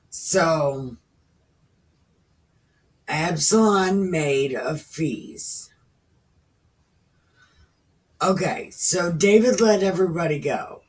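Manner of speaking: usual speaking voice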